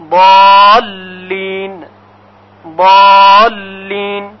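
بالین with the alif stretched long, then a doubled lam, very slow; long alif before doubled lam. Shown as a demonstration. Teacher showing students the stretched بالین. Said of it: "همینقدر که الف را تلفظ کردیم".

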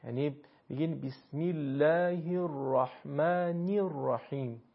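بسم الله الرحمن الرحیم slowly, with pure Arabic i vowels; Arabic kasra. Shown as a demonstration. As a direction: recite with Arabic i sounds, slowly. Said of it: "به صورت ای تلفظ".

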